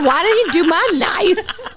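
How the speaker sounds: high, loud mocking whine; high whiny voice. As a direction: mimic her high whine. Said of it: "She said".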